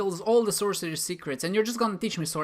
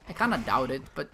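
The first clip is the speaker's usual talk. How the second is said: ordinary delivery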